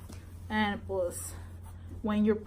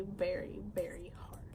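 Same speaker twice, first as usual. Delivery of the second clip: fading whisper; 3 semitones lower, 11 dB quieter than usual. Real, not acted. About pregnancy to viewers, fading to a whisper. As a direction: trail off to a whisper. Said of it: "fading emphasis".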